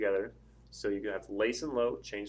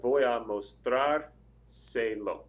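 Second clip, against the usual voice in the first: very slow, louder demo, "mostrárselo" stressed on "trár"; accent on mostrárselo's A; 5 dB louder, much slower than usual. Shown as a demonstration. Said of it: "you would have an accent mark there".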